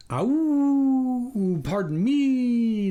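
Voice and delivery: high, slow, apologetic creature voice; a polite beast apologising from the dark; stretched, high, sorry-sounding stranger; apologetic canine voice. A narrator's reading. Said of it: "the apologetic voice returned".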